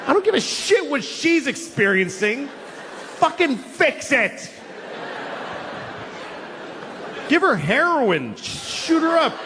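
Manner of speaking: high, exasperated mock yelling; hammed-up desperate outburst; frantic rant. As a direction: demand the vet just fix it. Ranting frantic at the vet.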